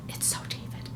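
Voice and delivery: breathy aside whisper. Real, not acted. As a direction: whisper it to yourself. Repeats in whisper.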